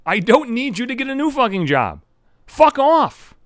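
high, heated brush-off ending loud; real irritation, pitch jumping up; angry dismissal. Real, not acted. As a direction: build to a loud, curt dismissal. Ranting at his absent father.

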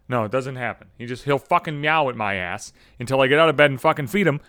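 ordinary delivery

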